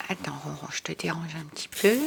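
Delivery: whispered to the cat, no voice. Real, not acted. Teacher whispers to the cat Ronron while moving it aside. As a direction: gently breathe the apology to the pet.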